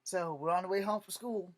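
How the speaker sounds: normal talking voice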